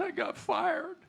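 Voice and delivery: high, choked crying voice; crying husband. Acted. Husband sobbing to his wife that he got fired. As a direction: wail it like a grown man sobbing. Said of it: "He's just crying".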